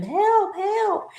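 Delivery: high mock cries for help; mock screaming. Retelling two women running in screaming for help. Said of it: "they're screaming".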